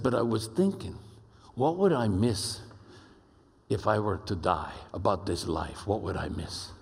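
deep imitated Spanish-accented voice, dropping low; Pablo's deep Spanish accent. Ambassador wondering aloud about death, imitated deep Spanish accent. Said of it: "deep Spanish accented voice like this".

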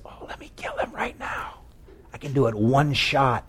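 urgent stage whisper; eager whisper. Acted. Abishai begging David beside sleeping Saul, whispered. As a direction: whisper it so the sleeper won't wake.

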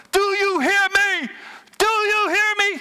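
high, loud desperate yell. Retold or imitated. Prodigal son yelling up to God, high and loud. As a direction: yell it up to heaven. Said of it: "he's like".

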